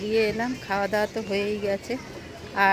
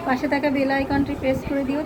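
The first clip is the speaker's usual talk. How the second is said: ordinary delivery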